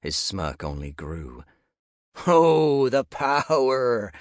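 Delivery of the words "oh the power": hammed-up wail, higher, louder and slow; mock dramatic cry. Nick mocking talk of power, hammed-up dramatic cry. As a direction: cry it out like a ham villain, mocking. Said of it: "Nick's mock dramatic cry".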